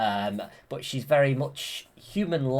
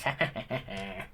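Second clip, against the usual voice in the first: imitated villain cackle, trailing off softer; Maleficent; 4 semitones lower, 6 dB quieter than usual. Maleficent gloating after her curse, imitated evil laugh. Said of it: "And then Maleficent goes".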